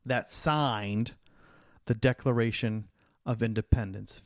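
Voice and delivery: slow emphatic question. Shown as a demonstration. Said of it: "name anyone else".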